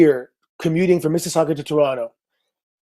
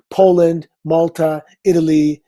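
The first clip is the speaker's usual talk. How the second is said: ordinary delivery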